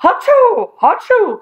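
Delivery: high, exaggerated hachoos; loud-mouthed mock sneezing fit; cried-out pretend sneezes. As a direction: cry out two fake sneezes. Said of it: "cried Joe".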